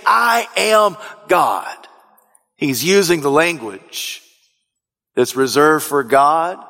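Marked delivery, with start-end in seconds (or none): 0.1-1.9 high, very slow stress on "I am"; emphatic declaration. Retold or imitated. Preacher voices Jesus claiming to be God, emphatic. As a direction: draw out "I am", then drop firmly onto "God". Climax of insistent preached quote.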